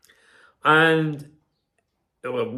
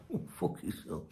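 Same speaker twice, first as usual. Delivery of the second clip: 1 mocking sign-off; 3 semitones higher, 14 dB quieter than usual. Real, not acted. Dismissive sign-off after reading message.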